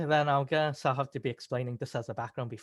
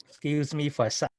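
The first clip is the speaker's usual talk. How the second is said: talking around a lozenge; mouth full; 2 semitones lower than usual. Real, not acted. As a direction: speak with a lozenge in your mouth. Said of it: "lozenges in my mouth".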